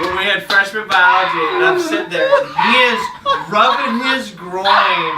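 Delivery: loud, high, laughing through the story; laughing while telling. Real, not acted. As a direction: crack up while retelling it. Retelling absurd class story laughing.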